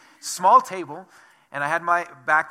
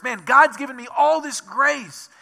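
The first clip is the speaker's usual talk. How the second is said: grateful excitement; 8 semitones higher, 8 dB louder than usual. Pretend. Imagined grateful believer voice.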